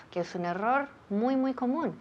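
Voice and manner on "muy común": ordinary talk, nothing marked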